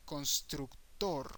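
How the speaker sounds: "constructor" as cons-trook-TOR, slowly; Spanish "constructor", open vowels, last syllable stressed; Spanish pronunciation. Shown as a demonstration. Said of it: "the pronunciation changes to".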